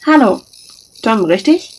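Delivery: bright, loud sugary greeting; sweetened voice. A narrator's reading. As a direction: sweeten it to win him over. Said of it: "sie versüßte ihre Worte".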